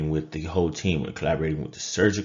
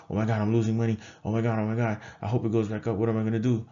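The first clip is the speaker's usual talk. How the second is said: mock freaking out; 5 semitones higher, faster than usual. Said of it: "We can't freak out".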